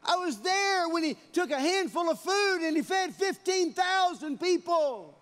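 loud, high preaching cry; preaching at full force. Acted. Preacher proclaiming the miracle to the congregation, at full force.

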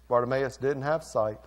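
normal talking voice

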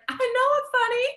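high, loud mock-smug boyfriend voice; hammed-up smug boyfriend; imitating boyfriend. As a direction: play up his cocky confidence. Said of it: "He's like".

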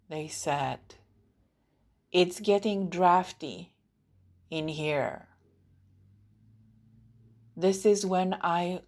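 measured poetry reading, firm line openings and long pauses; deliberate, projected poem reading; poem recitation. Acted. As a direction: recite it line by line, letting each image stand. Reads own poem aloud.

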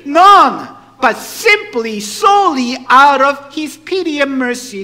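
high, booming sermon delivery; impassioned preaching. Acted. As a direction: drive each phrase home at full force.

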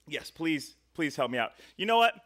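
normal talking voice

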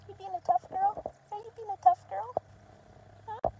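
put-on high, sing-song baby talk to puppies; talking to the puppies in a high cooing voice; puppy talk. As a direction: coo at the puppies like they're toddlers.

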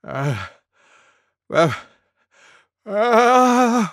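spaced gasps climbing high and loud; sneeze build-up. A narrator's reading. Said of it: "went Elephant".

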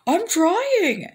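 high, loud, strained protest; strained doctor. Retold or imitated. Doctor answering the yelling patient, high loud strained protest. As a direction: push back, exasperated.

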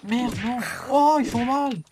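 high panicked yelps; panicking, cry out in alarm; scared horror game streamer. Real, not acted. Hurt by zombies in game.

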